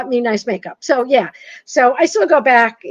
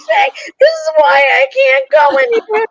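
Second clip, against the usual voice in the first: higher, louder, half-crying mock lament; anguished mock wail; 6 dB louder than usual. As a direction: wail it like a hammed-up heartbreak. Retelling humiliation at store counter.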